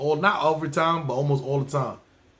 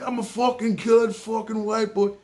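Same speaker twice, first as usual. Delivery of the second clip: furious cab driver; 7 semitones higher than usual. Retold or imitated. Cab driver vowing revenge.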